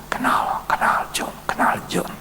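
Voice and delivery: unvoiced, struggling whisper of a dying man; weak whisper. Dying man answering the pastor in a faint whisper. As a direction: barely get the words out, breathless. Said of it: "Dengan susah payah omong".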